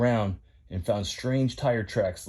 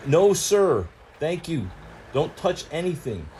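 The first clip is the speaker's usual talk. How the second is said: firm truck refusal; 5 semitones higher, 3 dB louder than usual. Acted.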